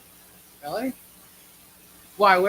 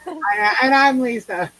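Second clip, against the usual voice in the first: laughing intro; 7 semitones higher, 7 dB louder, slower than usual. Real, not acted.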